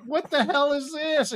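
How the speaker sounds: high, slow and baffled; exasperated disbelief at the screen; baffled outburst. Retold or imitated. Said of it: "I was like".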